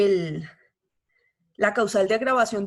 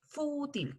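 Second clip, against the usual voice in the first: "Fútil" with a higher, drawn-out FÚ; stress on the U; 4 semitones higher, 11 dB quieter than usual. Shown as a demonstration. Teacher shows the tilde on the U, FÚ stressed in "Fútil". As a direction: lean on the ú of "Fútil", softly. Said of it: "con tilde en la U".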